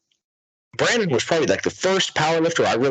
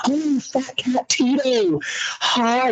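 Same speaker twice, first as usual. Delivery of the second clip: high-pitched, drawn-out fangirl squeal; fan gushing; 13 semitones higher, much slower than usual. Real, not acted. Podcaster calling out to a favourite lifter, gushing. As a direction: gush sky-high as if greeting a beloved cat.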